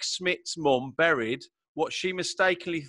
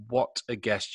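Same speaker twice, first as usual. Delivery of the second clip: ordinary delivery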